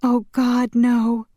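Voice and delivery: high, stricken daughter's voice; anguished pleading. Acted. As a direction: plead with your dying father. Daughter pleads with dying father.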